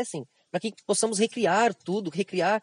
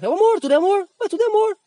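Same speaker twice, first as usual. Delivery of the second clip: high, loud mock enthusiasm; mocking people who call every pleasure love, high and loud; 13 semitones higher, 6 dB louder, faster than usual. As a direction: lampoon a greedy pleasure seeker, up high. Mocking the hedonist view of love.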